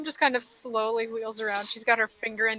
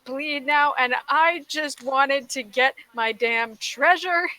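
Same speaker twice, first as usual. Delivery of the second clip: slow, higher, louder exasperated whine; exasperated Plume; 4 semitones higher, 4 dB louder, slower than usual. Acted. Plume complains about wanting only treasure, exasperated. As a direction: drag out the complaint, pitch raised. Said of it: "gets exasperated".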